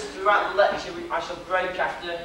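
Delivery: ordinary talk, nothing marked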